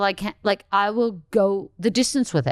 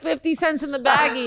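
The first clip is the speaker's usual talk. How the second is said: usual speaking voice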